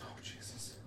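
breathy whispered dread; barely voiced, under the breath. Real, not acted. Dread at risky plan.

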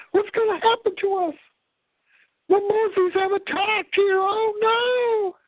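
high, frightened mock wail; imitating a scared mother pleading; scared crying woman. Imitating the frightened blonde mother at the gas station, high mock wail. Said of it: "she's crying because she's scared".

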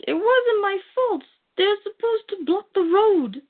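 high, pleading whine; whiny excuse. Retold or imitated. Villain begging Superman, a high whiny plea. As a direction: whine out the excuse like a cornered crook.

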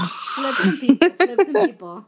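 loud excited chant; shouting. Real, not acted. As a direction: cheer it out loud. Cheering Mueller on, shouting.

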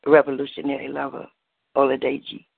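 measured rhythmic poem recital with dramatic pauses; spoken word poem. Acted. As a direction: perform it like a slam poem, savouring each line. Spoken-word love poem performance.

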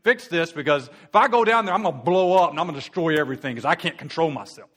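a God losing his temper, mocked; mock out-of-control God. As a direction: ham up a God about to blow. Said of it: "it's not like God's angry and says".

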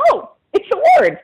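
very high-pitched imitation of amazed discovery; mock astonishment. Caller mimicking a coworker who just Googled it, astonished. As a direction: squeal the realisation as a mimicked coworker. Engineer surprised after Googling word.

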